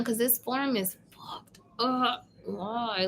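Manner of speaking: whispered grumble under the breath; whispered aside. Real, not acted. Reader grumbling about the broken form, whispered. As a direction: whisper it, annoyed, off to the side.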